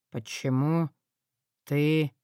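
low, wary question; lightly voiced suspicious man; suspicious dragon lord.

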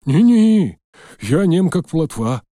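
put-on high, loud hurried old man; eager old man. A narrator's reading. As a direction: promise silence like a scared old man.

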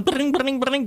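trilled phone-ring noise; spoken ringtone imitation; imitated telephone ring. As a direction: roll it like an old ringing phone. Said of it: "téléphone".

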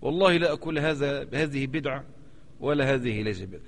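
light mock of false caution; mocking false piety. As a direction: parody a scholar who won't commit. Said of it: "ورع الأستاذ".

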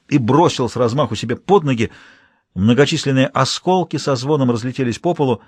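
plain reading voice

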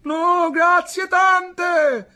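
loud, high, drawn-out shout; shouted reply. A narrator's reading. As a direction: call back slowly to the commander. Sailors shout back to commander.

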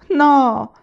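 sharp loud No of dismay; loud startled exclamation; exclaiming. A narrator's reading. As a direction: cry out in protest. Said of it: "esclamò Laura".

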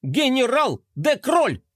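high, slow, emphatic yell. Acted. Little robot correcting the skater, yelling. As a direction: shout the full title. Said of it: "завопил Мелкотрон".

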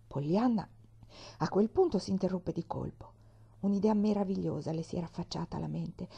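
ordinary delivery

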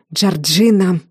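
ordinary delivery

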